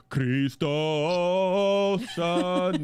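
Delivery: long drawn-out holiday cry, no real shouting; mock scream. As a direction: stretch it out like a mock proclamation. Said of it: "burst into my in-laws' house screaming".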